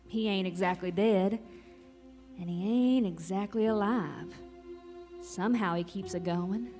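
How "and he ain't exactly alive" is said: plain reading voice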